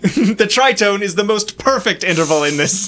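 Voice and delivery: gleeful laughing lecture; laughing professor. Acted. Professor cackles through the line.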